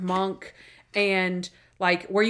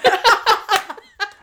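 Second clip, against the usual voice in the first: a high, loud burst of real laughter; 12 semitones higher, 8 dB louder than usual. Real, not acted. Laughing at forgotten quote.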